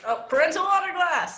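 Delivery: loud, high burst of discovery; excited shout. Real, not acted. Answer shouted across the class.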